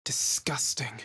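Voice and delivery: hushed, revolted whisper; "Disgusting" whispered low. Acted.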